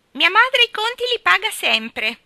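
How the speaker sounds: very high, loud singsong parroting; mocking repeat. A narrator's reading. Geraldine repeats Ivy's boast, mocking at high pitch. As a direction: mimic the other girl at a squeal. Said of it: "ripeté Geraldine".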